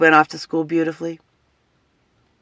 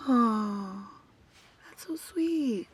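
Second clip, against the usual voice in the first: cooing; 4 semitones higher, 9 dB quieter, much slower than usual. Retold or imitated. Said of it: "And then I'm going".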